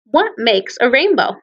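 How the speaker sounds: normal talking voice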